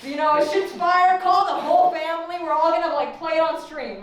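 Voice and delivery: high, loud hype-man announcement; dad bragging like a rapper's promoter; hyped dad. Retold or imitated. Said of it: "and he's like".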